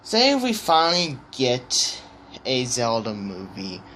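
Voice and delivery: normal talking voice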